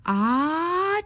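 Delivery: loud high sneeze bursting out; a giant dramatic achoo; big sneeze. Acted.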